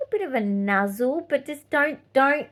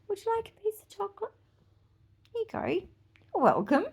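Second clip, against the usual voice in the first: sweet offering voice; 12 semitones higher, faster than usual. Shown as a demonstration. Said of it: "Just say".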